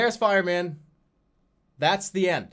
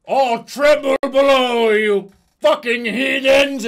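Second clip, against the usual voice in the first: booming, much higher and louder, slow giant Satan voice; demonic roar; 10 semitones higher, 12 dB louder, slower than usual. Pretend. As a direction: roar it out slowly like a towering demon. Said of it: "he would just stand there and be like".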